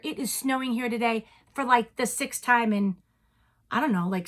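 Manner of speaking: ordinary talk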